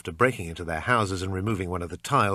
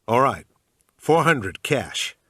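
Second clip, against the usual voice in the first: put-on loud, slow American-accented offer; bad American accent; 4 semitones higher, 7 dB louder, slower than usual. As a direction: haggle in a fake Yankee accent. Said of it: "in my usual bad American accent".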